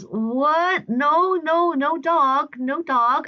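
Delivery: high-pitched, insistent mock bewilderment; baffled denial. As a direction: imitate a neighbor denying it as if you're nuts. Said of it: "looks at me like I'm nuts".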